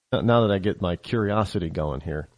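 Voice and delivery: ordinary talk, nothing marked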